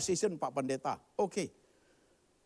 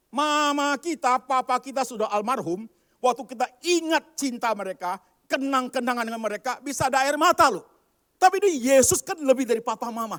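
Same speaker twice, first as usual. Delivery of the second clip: loud, high fervent preaching; preaching at full force; 12 semitones higher, 11 dB louder than usual. Acted. Pastor loudly pleading with the congregation about grief. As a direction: plead it out to the pews.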